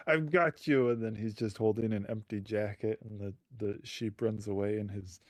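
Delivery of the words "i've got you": a mock triumphant cry, higher and louder; triumphant grab. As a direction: shout it like you finally caught the sheep. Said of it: "and he's like".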